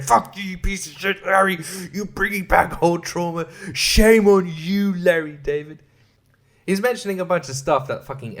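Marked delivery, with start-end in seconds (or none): 0.0-5.8 mock outrage. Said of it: "He's just saying, like".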